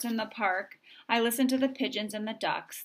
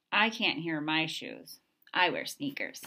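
plain reading voice

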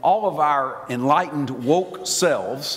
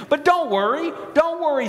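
high, bright announcing voice; playful high-pitched mock comfort; mock cheerful reassurance; 10 semitones higher than usual. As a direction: sing it out like help is coming. Mock rescuer announcing relief.

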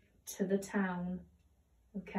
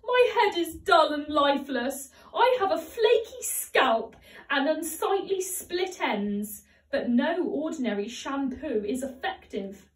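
wailing; 5 semitones higher, 6 dB louder than usual. A narrator's reading. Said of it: "she wailed".

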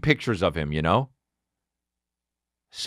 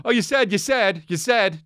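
high, repetitive tattling; mock tattletale; 6 semitones higher than usual. Mocking the guy who said you said, tattletale whine. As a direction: parody a whining accuser in a high voice. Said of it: "Such a tattletale, dude".

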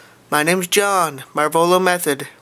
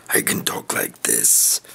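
slow, deep breathy whisper; 6 dB quieter than usual. Shown as a demonstration. Vlogger shows off his voices, slow low whisper.

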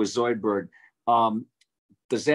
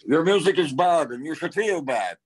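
higher, louder mushy lobster-alien voice; a much higher, louder Zoidberg scolding; 7 semitones higher, 3 dB louder than usual. Retold or imitated. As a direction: scold like a wobbly crustacean. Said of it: "and so Zoidberg".

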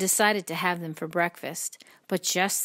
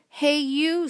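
high, loud storybook call-out; grouchy challenge; 9 semitones higher, 6 dB louder than usual. A narrator's reading. Grouchy ladybug accosting a stranger, high loud challenge. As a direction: call out for a fight, pitched way up.

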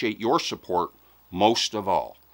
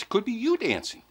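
playful high tease; 10 semitones higher, 4 dB quieter than usual. Acted. Teasing viewers about winning.

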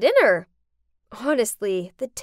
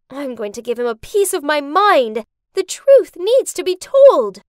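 indignant; 6 semitones higher, 7 dB louder than usual. Acted. Tracy learns father's betrayal.

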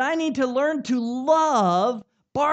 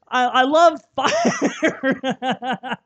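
laughing; 7 dB louder, much slower than usual. Real, not acted. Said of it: "laughs while saying it".

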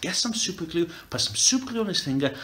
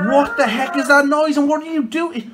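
loud, raised-pitch demand; alarmed shout; 4 semitones higher, 9 dB louder than usual. Real, not acted. Catching a mate grinding their teeth, alarmed shout. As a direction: shout it in disbelief. Startled by noise, confronts mate.

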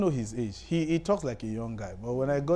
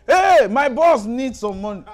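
loud, high, gleeful announcing to the whole bank; bragging shout about his rich boss; retold excited shouting; 11 semitones higher, 11 dB louder than usual. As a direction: shout it out proudly to the room. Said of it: "he started shouting".

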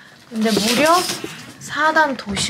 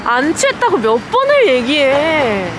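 loud, higher, tipsy protest; 5 semitones higher, 5 dB louder than usual. Real, not acted. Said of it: "언니 취했어요".

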